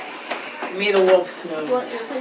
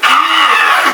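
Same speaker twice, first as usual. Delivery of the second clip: high, loud goat shriek; frightened scream; 7 semitones higher, 9 dB louder than usual. Acted. Goats see the bee's stinger, screaming. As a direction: scream as if stung.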